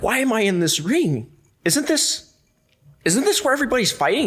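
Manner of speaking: high, fast, alarmed self-quote; panicked confusion. Retold or imitated. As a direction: squeak it out like a rookie who just realised he's fighting. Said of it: "I'm like".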